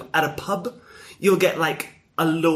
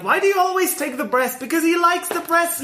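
high, lively argument about chicken; mock family yelling; 12 semitones higher than usual. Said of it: "we're yelling about chicken".

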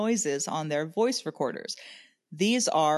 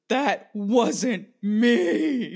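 very slow, louder mock-dramatic delivery; overacted ghost host coming out of possession; hammed-up post-possession denial; 4 semitones higher, 4 dB louder, much slower than usual. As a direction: drag out every word, pitched up, like a bad actor. Acts out host after fake possession.

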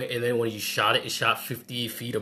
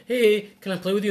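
high, hopeful asking; timid kid voice; 10 semitones higher, 4 dB louder than usual. Retold or imitated. Lonely rich kid asking other kids to play, high hopeful voice. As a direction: ask shyly like a lonely kid. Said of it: "He's like".

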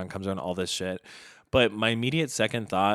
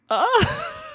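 a much higher, loud laughing cry; laughing shriek; 10 dB louder than usual. Real, not acted. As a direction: squeal with a laugh at yourself. Laughs at own empathy.

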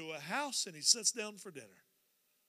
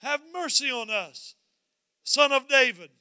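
calling out; 4 semitones higher, 8 dB louder than usual. Acted. Blind men calling after Jesus for mercy, calling out. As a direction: cry out for mercy, raised and stretched. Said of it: "they're calling out, and they say".

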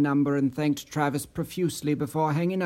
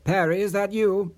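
raised, anxious questioning; higher-pitched worried phone greeting; concerned; 5 semitones higher than usual. A narrator's reading. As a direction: ask with mounting concern. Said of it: "in a concerned tone".

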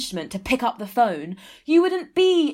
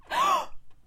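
shocked gasp; 4 dB quieter than usual. Acted. Shocked gasp on phone call.

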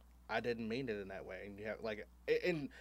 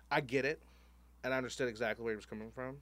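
usual speaking voice

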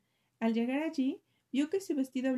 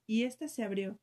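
ordinary delivery, nothing marked

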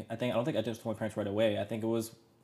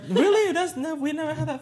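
much higher, startled disbelief; incredulous dad; 8 dB louder than usual. Retold or imitated. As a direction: play the dad who can't believe it. Dad reacting, retold again.

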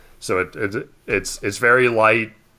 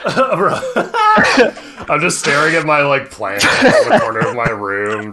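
slow, higher words through laughter; laughing; 5 semitones higher, 4 dB louder, slower than usual. Real, not acted. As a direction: giggle while confessing. Laugh heard, admitting distraction.

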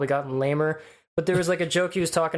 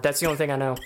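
normal talking voice

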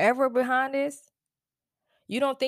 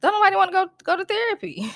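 high, louder teasing exclamation; mocking outburst; 11 semitones higher, 5 dB louder, faster than usual. Real, not acted. To viewers who avoid therapy, high teasing outburst. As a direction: shout it up high with a laugh behind.

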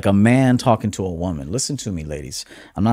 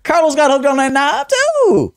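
playful teasing; 13 semitones higher, 4 dB louder than usual. Real, not acted. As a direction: tease your buddy in a squeaky-high voice. Playfully teases Carlos by name.

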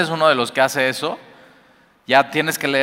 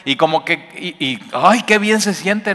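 réplica altanera imitada, algo más aguda; imitando enojo; 5 semitones higher than usual. Retold or imitated. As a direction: se burla imitando al que se pone al tú por tú. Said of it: "tu carne lo que va a querer hacer es subir el nivel y, y gritar". (imita a la carne que quiere gritar y humillar, con enojo fingido)

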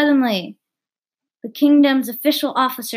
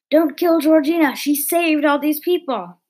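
put-on pleading boy; 5 semitones higher, 4 dB louder than usual. Lewis pleads to save Georgina.

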